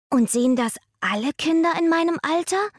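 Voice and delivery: put-on high excited boy voice; eager child. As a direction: ask it eagerly like a little boy.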